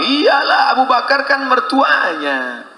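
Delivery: high, slow sneering voice with a laugh; mock gossip. People gossiping about Abu Bakar, sneering mock voice. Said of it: "apa kata orang?".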